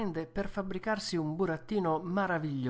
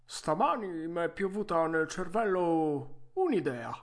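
vecchietto arzillo, voce forte e più alta; Geppetto arzillo; 3 semitones higher than usual. Acted. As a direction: saluta forte, da vecchietto pimpante. (vecchietto arzillo a voce alta)

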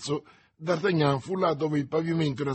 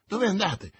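insistent repeat; 6 semitones higher than usual. A narrator's reading. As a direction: press him for an answer. Servant repeats, louder.

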